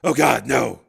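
loud, grief-stricken cry; anguished. Acted. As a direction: moan it out in disbelief and grief. Man kneels over her body.

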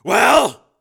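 loud, tense prompt; impatient demand. A narrator's reading. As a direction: press her for the answer. Impatient father.

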